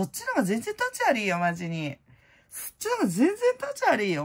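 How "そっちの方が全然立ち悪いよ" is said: much higher and louder, stress on 立; emphatic high repeat. Shown as a demonstration. Repeating her point about tax dodgers, a high emphatic outburst. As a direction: push it up high and hammer 立ち悪い. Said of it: "repeated with more force".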